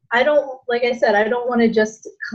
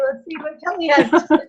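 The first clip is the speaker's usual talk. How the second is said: laughing through the words; giggling mid-sentence; 6 semitones higher, faster than usual. Real, not acted. Caught Kelly eating, laughing.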